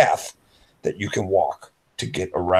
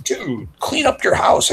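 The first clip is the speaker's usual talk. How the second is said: higher, slow, drawn-out plea; higher slow pleading dude; pleading urge; slower than usual. Retold or imitated. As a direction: stretch it out, begging him. Urging friend to clean up.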